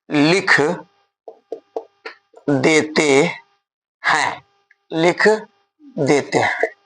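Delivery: drawn-out words with long pauses between; slow emphatic dictation. Shown as a demonstration. Teacher to weak students, slowly stressing the rule. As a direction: spell out the rule slowly and firmly. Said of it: "धीरे धीरे बोलते हैं".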